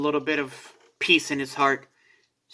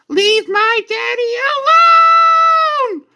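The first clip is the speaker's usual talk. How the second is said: much higher, stretched-out cry of leave my daddy alone; child's scream; 10 dB louder than usual. Acted. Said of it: "He screams".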